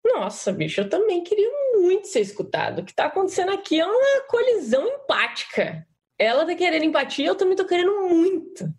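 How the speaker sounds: high, emphatic self-talk; acted inner plea. Shown as a demonstration. Participant acts out inner empathic collision, high and pleading. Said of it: "fazendo aqui mais devagar".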